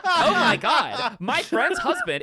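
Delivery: high, drawn-out mock influencer voice; breathy fake TikTok narrator, laughing. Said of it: "how are you so good at the TikTok voice".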